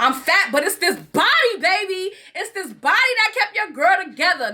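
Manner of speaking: high, put-on sassy voice; mock people bragging about their body, hammed up. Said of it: "people be talking about".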